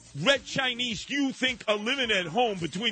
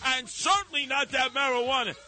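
angry dad; 7 semitones higher than usual. Retold or imitated. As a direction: bark at the son like a fed-up dad. Dad scolding son.

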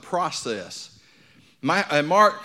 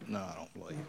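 much lower and softer admission; quiet doubting answer; 9 semitones lower, 16 dB quieter than usual. Retold or imitated. The man admitting his doubt, low and soft. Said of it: "And he".

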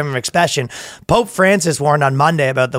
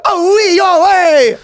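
much higher, louder cartoon Italian cry; mock Italian exclamation; 6 dB louder, slower than usual. Italian translator crying out over closures, in a cartoon Italian accent. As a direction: throw up your hands in a cartoon Italian accent. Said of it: "He go".

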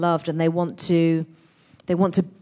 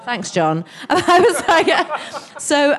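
ordinary delivery

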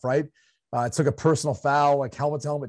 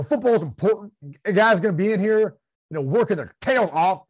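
a higher, rushing coach who swallows his endings; excited coach voice; 6 semitones higher than usual. Shown as a demonstration. Showing co-host how excited coaches talk, rushed and chopped. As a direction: run the sentences together and chop the ends. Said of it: "don't wanna get the last word out".

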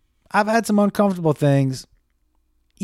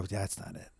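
muttered under breath; 7 semitones lower, 17 dB quieter than usual. Retold or imitated. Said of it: "I just hear him go".

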